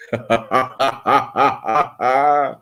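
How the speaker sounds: high, open ha-ha-ha; real burst of staccato laughter; laughing. Real, not acted. Laughs at being called blurry.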